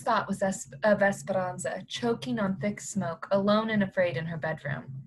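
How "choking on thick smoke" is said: plain reading voice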